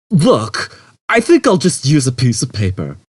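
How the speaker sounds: Zaphod. A narrator's reading. Zaphod fed up.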